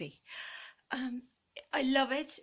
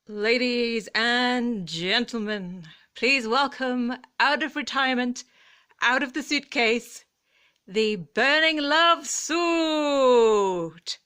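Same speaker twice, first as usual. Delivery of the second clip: compere announcement; 3 semitones higher, 10 dB louder, slower than usual. Pretend. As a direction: build it like a Vegas compere presenting a star.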